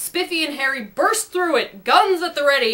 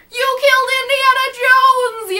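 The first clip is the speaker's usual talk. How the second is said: much higher, louder yell of a lady; shocked yell; 13 semitones higher, 7 dB louder than usual. A narrator's reading. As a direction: yell it in horror at the shooting. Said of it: "yelled some lady".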